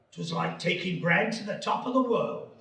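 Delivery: put-on old voiceover in a funny regional accent; hammed-up rustic British accent; comedic parochial accent. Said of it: "funny accents".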